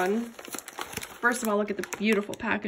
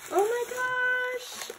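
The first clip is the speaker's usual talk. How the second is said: high, slow squeal of "Oh my gosh"; high, drawn-out gasp of "Oh my gosh" in delight; amazed; 13 semitones higher, slower than usual. Real, not acted. Amazed at the gift.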